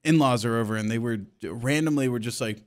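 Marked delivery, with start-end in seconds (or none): none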